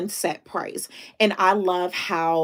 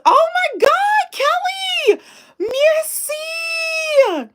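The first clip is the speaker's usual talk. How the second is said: high-pitched, drawn-out, much louder squeal of delight; real thrilled squeal, very high and slow; excited squeal; 7 dB louder, much slower than usual. Real, not acted. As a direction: shriek with real joy at the gift. Thrilled at Kelly's super chat.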